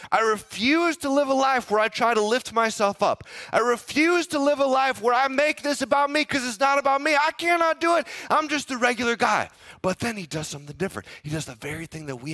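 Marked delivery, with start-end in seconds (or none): 0.1-9.5 firm refusal. Retold or imitated. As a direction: preach it, no pride allowed. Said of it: "he said".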